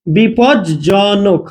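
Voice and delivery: slow teaching demo of বিপদজনক; slow drawn-out demo. Shown as a demonstration. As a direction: draw বিপদজনক out very slowly. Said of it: "বিপদজনক থেকে মনে করেন এরকম করলাম আমি".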